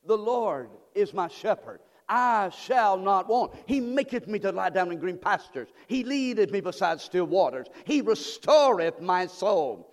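call and response recitation. Shown as a demonstration. Pastor leading the congregation in the psalm, slow and loud. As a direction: lead the church line by line. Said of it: "Say that with me".

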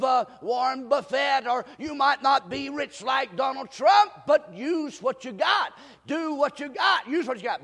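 high, sustained, chant-like preaching; fervent preaching. Real, not acted. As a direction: drive the point home with stretched, emphatic words. Preaches at raised pitch.

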